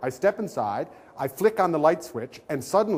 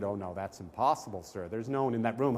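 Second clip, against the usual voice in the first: calm desk clerk; 4 semitones lower, 6 dB quieter than usual. Retold or imitated. Desk clerk dismisses complaint.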